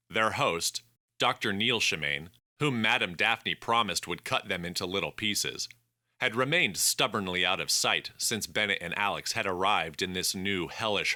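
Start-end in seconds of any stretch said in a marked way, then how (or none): none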